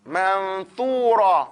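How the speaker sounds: Manthura said very slowly, the th clear; slow drawn-out demo. Shown as a demonstration. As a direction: stretch it out for students. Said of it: "So we're gonna say manthur".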